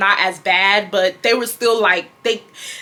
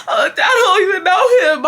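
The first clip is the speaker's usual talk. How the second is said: high, whiny crying imitation; hammed-up tearful denial; mock crying; 9 semitones higher, 4 dB louder than usual. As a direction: wail it like a busted teenager. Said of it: "I just start crying".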